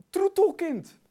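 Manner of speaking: high, loud, savoured word; delighted exclamation. Real, not acted. Preacher savouring an odd Bible word, delighted exclamation.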